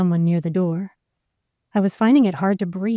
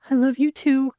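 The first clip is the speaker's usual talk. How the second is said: pleading reply; 6 semitones higher than usual. A narrator's reading. Tearful reply to dying lover.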